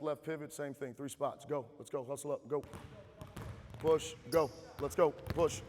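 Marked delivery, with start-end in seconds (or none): 3.8-5.6 loud drill calls; barking gym coach. Real, not acted. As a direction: bark each command short. Coach calling drill to players.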